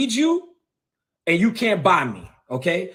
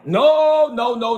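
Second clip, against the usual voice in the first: high, loud no; 5 semitones higher, 5 dB louder, slower than usual. Real, not acted. Refusing to be called bad, shouting. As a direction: shout the no higher and louder. Defiant rant at critics.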